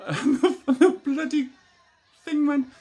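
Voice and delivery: laughing loudly through the words. Real, not acted. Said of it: "laughing while telling".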